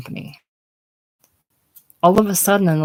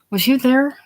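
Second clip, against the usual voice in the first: high, louder eager question; quite excited; 8 semitones higher, 4 dB louder than usual. A narrator's reading. Emily Ann asks the boy about her show, excited. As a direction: ask it eagerly, voice jumping up. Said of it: "She is quite excited now".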